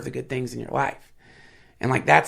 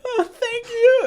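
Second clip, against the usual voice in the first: high, choked crying thank you; tearful thank you, voice breaking high; 4 dB louder, slower than usual. Real, not acted. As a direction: sob it out, overwhelmed. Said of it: "Started crying again".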